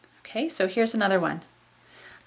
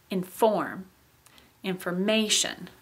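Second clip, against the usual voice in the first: inFORM then inforMAtion, stress moving to MA; shifting stress; slower than usual. Shown as a demonstration. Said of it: "So listen".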